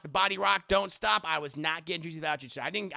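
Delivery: normal talking voice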